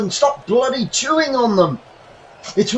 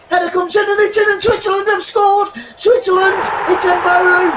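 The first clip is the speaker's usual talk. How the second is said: excited goal call; 8 semitones higher than usual. Real, not acted. Commentator calling the opposing goal, excited. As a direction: scream the goal as it goes in.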